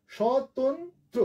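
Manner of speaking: স্বতন্ত্র drawn out very slowly, each sound clear; স্বতন্ত্র spelled out slowly for the class; slow demo. Shown as a demonstration. Teacher models the word for students, very slow. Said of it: "এই যে দেখো".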